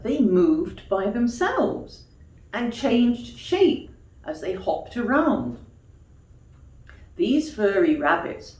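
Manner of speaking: put-on gentle storybook reading for children; story narration. A narrator's reading. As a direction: tell it like a bedtime tale. Reads a bedtime story.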